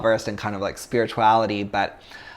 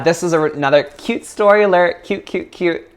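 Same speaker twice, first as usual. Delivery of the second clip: playful cute announcement; 6 semitones higher, 4 dB louder than usual. Acted. Playful story announcement to class.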